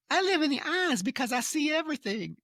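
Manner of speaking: put-on high-pitched Southern boy alter; put-on falsetto Southern boy voice.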